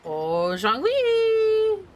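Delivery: high, drawn-out singsong call; playful calling out. Pretend. Streamer calls out for Zhongli in the game, high singsong. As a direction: call out in a long, rising singsong.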